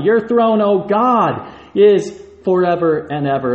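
"Your throne, O God" rung out high and loud; preached proclamation. Shown as a demonstration. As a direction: proclaim it like a herald. Repeats the verse with force.

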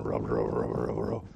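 hungry stomach rumbling noise; growling tummy. Retold or imitated. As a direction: growl it like an empty belly. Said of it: "this tummy is going".

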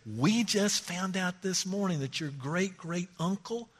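put-on calm the boy down gently; reassuring dad. Dad comforting his son about the truck, softly. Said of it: "Dad comes along and says".

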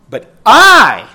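emphatic I, high and loud; stress on I. Shown as a demonstration. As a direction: slam the word I. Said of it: "you're doing it to be emphatic".

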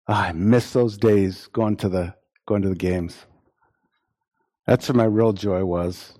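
pining for old days, half-mocking; mock nostalgia. Mock nostalgic sports fan.

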